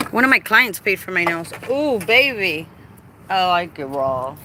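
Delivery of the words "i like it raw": ordinary talk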